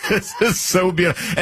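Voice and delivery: tickled, high-pitched appreciation; amused delight. Real, not acted. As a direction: relish it with a laugh. Laughing at the clip he played.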